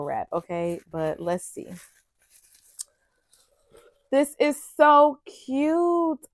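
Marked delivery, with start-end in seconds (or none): 4.1-6.3 gushing. Real, not acted. Delighted unboxing, slow gush.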